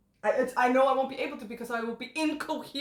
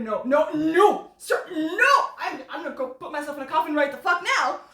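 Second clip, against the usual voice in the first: mock overwhelmed protest; 3 semitones higher, 7 dB louder than usual. Imagining seeing the actor in a kilt, loud mock protest. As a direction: ham up being overwhelmed. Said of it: "I'll be like".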